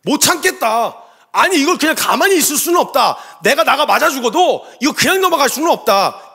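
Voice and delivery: loud, high, impassioned outburst; can't stand it. Retold or imitated. David cannot bear God being insulted, loud acted indignation. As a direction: build to a forceful, defiant declaration. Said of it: "이거 견딜 수 없었다".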